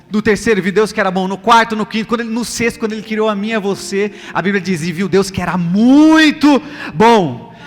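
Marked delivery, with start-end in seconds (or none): none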